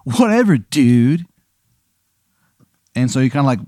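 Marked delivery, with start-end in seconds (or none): 0.0-1.2 lightly higher surfer brush-off; dismissive surfer. Acted. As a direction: shrug the player off. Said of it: "he goes".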